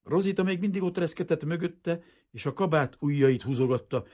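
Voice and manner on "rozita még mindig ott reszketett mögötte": ordinary delivery, nothing marked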